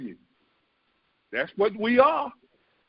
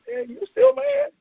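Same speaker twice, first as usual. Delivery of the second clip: much higher, teasing onlooker voice; mocking onlooker. Bystanders staring at the angry one, high mocking. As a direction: tease like a bemused bystander. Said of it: "They looking at you like".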